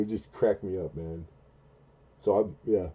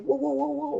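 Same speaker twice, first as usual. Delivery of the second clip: very high drawn "whoa" to calm someone; mock calming whoa. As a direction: hold up your hands and settle him. Calming the boss down.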